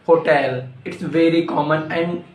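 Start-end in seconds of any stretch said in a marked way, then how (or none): 0.1-0.7 "hotel" as ho-TELL; corrected HOTEL. Shown as a demonstration. Teacher correcting learners, "hotel" said as ho-TELL. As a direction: say "hotel" with a full TELL ending.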